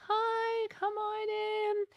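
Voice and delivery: put-on very high, slow sweet old-lady greeting; old neighbor lady. Imitating the widowed neighbor welcoming him in, very high and slow. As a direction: draw out a tiny sweet welcome at the door. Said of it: "she'd be like".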